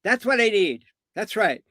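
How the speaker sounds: high-pitched emphatic agreement; placated client. Retold or imitated. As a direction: agree like a client finally heard. Client's relieved answer.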